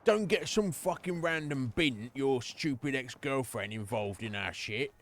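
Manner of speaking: mock angry rant, slow; sneering impression of a rude gangster; hammed-up dismissive boss voice; mimicking Sebastian. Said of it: "She's like".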